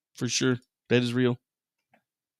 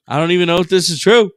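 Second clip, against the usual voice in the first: louder, fast; 4 semitones higher, 8 dB louder, faster than usual. Real, not acted. Louder second take.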